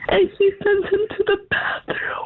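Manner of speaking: high, tearful, voice giving out; real crying. Real, not acted. Mother telling how her son was sent away, crying. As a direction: choke up and let the voice thin into tears.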